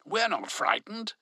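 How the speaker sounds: retort. A narrator's reading. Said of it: "Velveen retorted".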